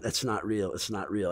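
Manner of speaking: low, soft self-reassurance; talking to oneself. Retold or imitated. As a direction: say it under your breath to steady yourself. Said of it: "I just said to myself".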